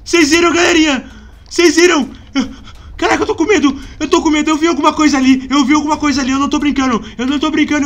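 loud, urgent, shaken insisting; agitated insisting. Real, not acted. Shaken gamer insisting to viewers he saw a monster.

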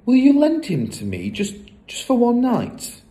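put-on high-pitched child's plea; pleading. A narrator's reading. Matilda asks Fred to lend his parrot, pleading. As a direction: beg eagerly, pitch lifted.

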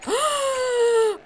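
sharp high shocked gasp; loud gasp. Real, not acted.

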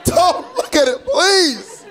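high shouted begging; pleading mom. Retold or imitated. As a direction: beg in a panic, voice up high.

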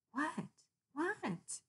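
high, eager yell; yelled what. Acted. Kitty yells at the whispering unicorn, high eager. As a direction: burst out impatient. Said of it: "yells Kitty".

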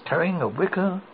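ordinary delivery

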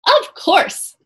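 high, bright chirp; piping. A narrator's reading. Annie piping up cheerfully to Morgan. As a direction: pipe up eagerly. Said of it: "piped Annie".

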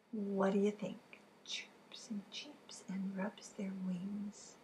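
slow whispered hints. Real, not acted. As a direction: hush the clues slowly.

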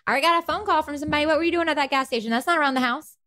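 high, rapid-fire mock interrogation; mom questioning. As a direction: grill the kid like a suspicious mom. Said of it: "my mom's like".

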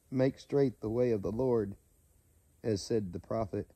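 measured, solemn Bible reading; steady preacher reading John aloud; scripture reading. Acted. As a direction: read the verses aloud like a preacher.

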